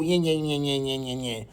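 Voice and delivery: low, fading nonsense mimicry; mocking little voice. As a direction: trail away softly, mimicking his chatter. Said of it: "with this little".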